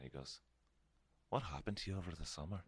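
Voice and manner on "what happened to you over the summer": put-on light Scottish accent, quick. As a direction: imitate a Scottish vocal coach. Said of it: "he said in his Scottish accent".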